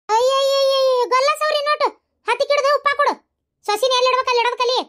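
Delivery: high-pitched exasperated outburst; indignant scolding. Acted. Mother-in-law objecting to coddling the daughter-in-law, indignant scolding.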